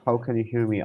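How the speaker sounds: usual speaking voice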